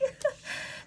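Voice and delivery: high, breathless squeal of laughter; helpless high-pitched laugh trailing off. Real, not acted. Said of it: "Sorry, I'm just laughing".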